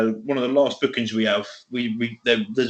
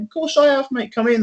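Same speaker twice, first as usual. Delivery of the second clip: eager welcome; 12 semitones higher than usual. Retold or imitated. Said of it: "like that".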